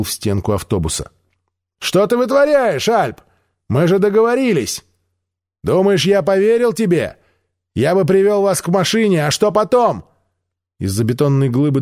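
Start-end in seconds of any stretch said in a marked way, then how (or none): 1.8-4.8 high indignant shouting; angry shout. Acted. Мира возмущённо кричит на Альпа, высоким голосом. Mira angrily confronts Alp. 5.6-10.0 high raised distrustful call; shouting back. Acted. Альп кричит Мире в ответ, недоверчиво.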